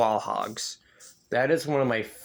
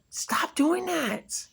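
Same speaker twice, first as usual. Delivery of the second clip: yelling at screen; much slower than usual. Retold or imitated. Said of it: "I'm like".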